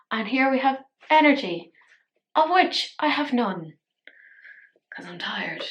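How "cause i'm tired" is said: soft unvoiced tired whisper. Real, not acted. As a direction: whisper it, exhausted. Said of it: "I'm so tired".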